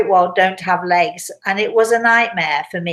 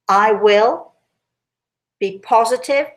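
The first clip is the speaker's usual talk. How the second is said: "will" lifted, "positive" rising and stressed. Shown as a demonstration. Explaining power words to listeners, stressing them. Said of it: "Positive is power word".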